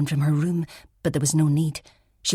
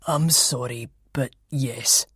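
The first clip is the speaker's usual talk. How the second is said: low, slow, regretful; sombre detective; 3 semitones lower, slower than usual. Acted. Detective to Elspeth, low and regretful. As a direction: confirm it gently.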